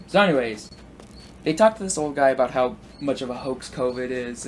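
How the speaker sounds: ordinary talk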